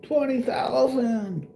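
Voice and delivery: hammed-up announcer voice; mock announcer. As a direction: stretch "Twenty thousand" out like a game-show host. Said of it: "And be like".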